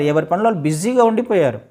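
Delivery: ordinary delivery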